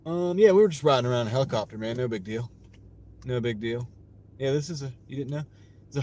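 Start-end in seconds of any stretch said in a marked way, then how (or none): none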